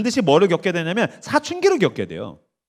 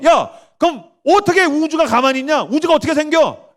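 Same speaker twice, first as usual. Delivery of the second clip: challenging; 7 semitones higher than usual. Acted. Voices a challenge to the deniers.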